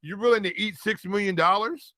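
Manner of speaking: ordinary talk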